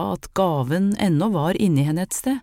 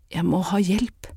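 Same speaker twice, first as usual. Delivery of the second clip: trembling voice; 2 semitones higher than usual. A narrator's reading. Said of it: "hørte selv hvordan stemmen skalv".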